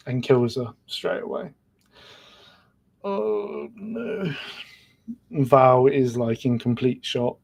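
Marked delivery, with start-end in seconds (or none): 3.0-4.3 dismayed gasp. Real, not acted. Reacting to a character's death.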